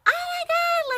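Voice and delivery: very high, loud fan scream. Retold or imitated. Fans screaming at the dance idea, very high and loud. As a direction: shriek it in disbelief. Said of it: "like, all screaming".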